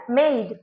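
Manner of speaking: "मेड" with a long ay vowel; long A sound. Shown as a demonstration. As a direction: pronounce "मेड" like English made. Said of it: "ए sound हो रहा है".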